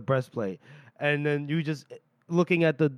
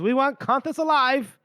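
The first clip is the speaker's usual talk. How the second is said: high, insistent retold interjection; acted officer cutting in, pitch pushed up; urgent demand; 8 semitones higher, 4 dB louder than usual. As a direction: jump in with a mock-urgent order. Lorson jumps in with a demand.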